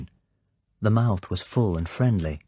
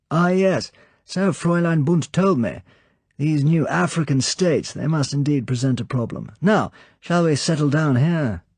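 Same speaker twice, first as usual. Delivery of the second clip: high, louder, animated villain's welcome; the Count's voice; 8 semitones higher, 5 dB louder than usual. Acted. Count welcomes Sir Hilary, light animated high voice. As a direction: greet the guest lightly, full of animation.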